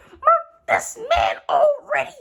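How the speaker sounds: high, slow, strained cry at the villain; fed-up yell. Real, not acted. Fan fed up with the villain, high strained cry. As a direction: beg the story to finish him off.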